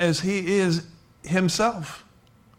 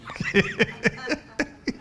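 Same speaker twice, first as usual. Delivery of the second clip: high laugh; 11 semitones higher than usual. Real, not acted. Laughs at own joke.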